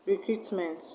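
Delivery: "recruitment" with the stress on CRUIT; stress on second syllable. Shown as a demonstration. Teacher showing unstressed affixes, "recruitment" stressed on CRUIT. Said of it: "we've stressed them as though they were two syllable words".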